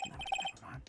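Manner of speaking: hushed, breathless aside; whispered aside. Acted. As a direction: drop it under your breath. Muttered aside.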